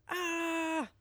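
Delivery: high excited shout; excited yell. Shown as a demonstration. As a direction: let out a thrilled yell. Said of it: "just go".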